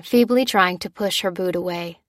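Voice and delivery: usual speaking voice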